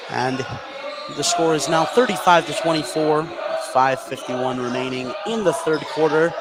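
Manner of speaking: ordinary delivery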